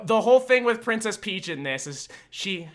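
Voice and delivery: usual speaking voice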